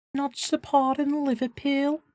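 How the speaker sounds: high-pitched put-on Scouse accent; Liverpool accent. Streamer echoing a Liverpool fan, high Scouse accent. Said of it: "I do Liverpool".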